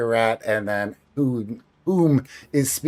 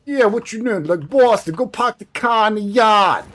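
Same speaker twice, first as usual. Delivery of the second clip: Boston accent; 8 semitones higher, 5 dB louder than usual. Shown as a demonstration. Showing how Boston folks talk, exaggerated Boston accent. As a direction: stretch the A's and R's like a Bostonian. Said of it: "that kind of elongated A's and R's".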